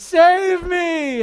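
loud, high desperate shout; cry for help. Acted. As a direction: cry out as you sink. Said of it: "He cries out to Jesus".

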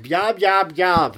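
loud, high imitation of someone jabbering; high, loud mocking blather; mock yapping. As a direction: yap it out loudly as a mimicry. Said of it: "He'll be like".

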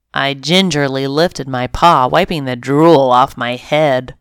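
usual speaking voice

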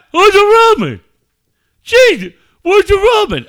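loud, high mock outburst; hammed-up panicky shouting; freaking out. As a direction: imitate a kid melting down, yelled high. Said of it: "every night you freak out".

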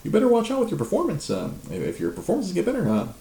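high, mocking voice for a smug coworker; high, prissy threat mimicking the coworker; mocking Martin. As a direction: squeak it out like a smug lapdog. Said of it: "he's like".

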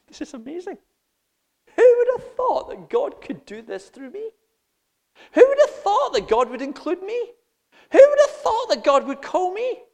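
marvel, climbing with each question; amazed wonder. Pretend. Humble believer marvelling that God would use them, rising awe.

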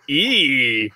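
robotic cab voice; automated voice. Retold or imitated. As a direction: imitate a car's computer. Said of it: "an automated voice goes".

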